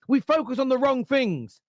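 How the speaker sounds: ordinary talk, nothing marked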